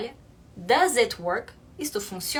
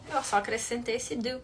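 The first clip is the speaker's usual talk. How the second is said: normal talking voice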